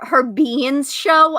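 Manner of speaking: ordinary talk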